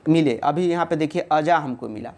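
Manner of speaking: usual speaking voice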